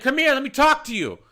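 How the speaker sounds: imitated high, loud demand; yelling. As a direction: holler it like an irate customer. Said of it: "what are you yelling at".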